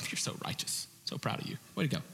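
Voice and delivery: breathy near-whisper of praise; mock praise from God. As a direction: hush it like a fond aside. God praising a law-keeper.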